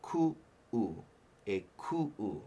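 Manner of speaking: "ku-u" split by a sharp catch in the throat; glottal stop demo. Shown as a demonstration. Teacher shows students the break inside "ku-u". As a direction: attack the second "u" of "ku-u" after a break. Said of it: "You wanna attack it".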